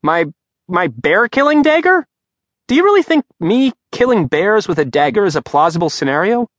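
panicked, indignant shout after the teacher; incredulous outcry. Acted. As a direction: call out in flustered disbelief. Simon calling after teacher, incredulous.

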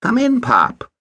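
lightly voiced smug boy; patronizing boy. A narrator's reading. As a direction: wave him in like you own the place. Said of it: "tone of easy patronage".

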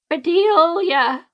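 a high, drawn-out wail of a name. A narrator's reading. Old woman recognizing a lost friend's ghost, wailing. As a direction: cry the name out in grief. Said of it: "Myrtle wailed".

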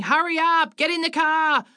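put-on high-pitched, hurrying call to the kids; narrated yelling. A narrator's reading. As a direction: yell it high without full volume. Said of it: "dragging them out and yelling".

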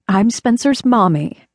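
ordinary delivery, nothing marked

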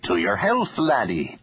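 put-on jovial overdone Irish accent; exaggerated Irish brogue. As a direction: ham up an Irish brogue for the toast. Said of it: "he said in an exaggerated brogue".

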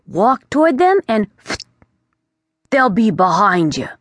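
put-on a know-it-all bat lecturing the boy; chatty bat. The bat warns the young prince, breezy and chatty.

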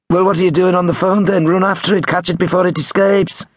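high Liverpudlian prankster. A narrator's reading. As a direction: fire off the punchline in high Liverpudlian. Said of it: "in his best Liverpudlian".

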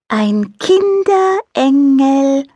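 slow, overly clear angel voice; over-distinct. A narrator's reading. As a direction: spell out Kinderengel slowly as if to a slow child. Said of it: "Bömmelchen sprach überdeutlich".

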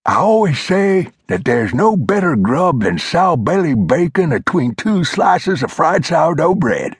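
put-on high, reedy old-timer lecturing; old mountain man voice. A narrator's reading. Old Glover scolding Flintlock, high old-timer voice. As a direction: play the cranky old coot setting him straight. Glover praises his grub.

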